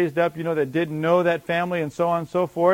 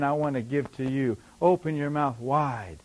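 quieter, tender offer; soft voiced God; 5 semitones lower, 6 dB quieter than usual. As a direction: say it softly, as a gift. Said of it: "No, he's saying".

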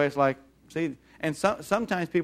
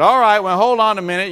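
stern call; 4 semitones higher, 8 dB louder than usual. Retold or imitated. Father stepping in sternly.

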